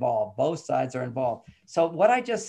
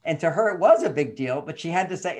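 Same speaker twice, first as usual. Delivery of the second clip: ordinary talk, nothing marked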